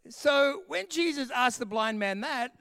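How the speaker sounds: ordinary talk, nothing marked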